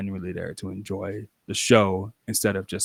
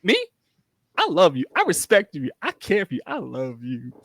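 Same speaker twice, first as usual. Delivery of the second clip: bashful gushing; 8 semitones higher than usual. Retold or imitated. Towel Boy answering fans.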